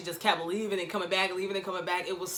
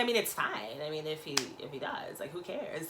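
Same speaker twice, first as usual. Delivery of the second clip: much softer, trailing, unbothered; mock offhand shrug; 4 semitones lower, 8 dB quieter than usual. [name] brushing it off, soft and unbothered. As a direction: shrug it off quietly.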